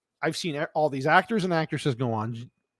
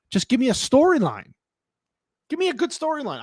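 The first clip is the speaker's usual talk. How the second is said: exasperated plea; 9 semitones higher than usual. Real, not acted. Pleading exasperated at writers.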